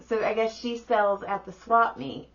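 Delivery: ordinary talk, nothing marked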